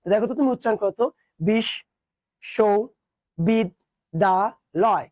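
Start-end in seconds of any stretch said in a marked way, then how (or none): 1.5-5.0 বিশ্ববিদ্যালয় very slowly as bish, sho, bid, da, loy; slow syllable-by-syllable demo; five separate syllables. Shown as a demonstration. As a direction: break it into five drawn-out beats. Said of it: "পাঁচবারই উচ্চারণ করেছো".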